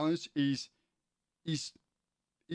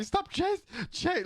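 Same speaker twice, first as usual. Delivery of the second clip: very high, playful camp squeal; camp character; slower than usual. Retold or imitated. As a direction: squeak it out like a flamboyant crook on the run. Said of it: "He acted really camp".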